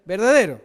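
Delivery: "verdadero" punched out loud and falling; stress on verdadero. Shown as a demonstration. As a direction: hit the word "verdadero" hard. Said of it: "la palabra verdadero está escrito tres veces". (acento fuerte en "verdadero")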